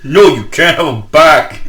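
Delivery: hammed-up refusal from the shop; mock store clerk.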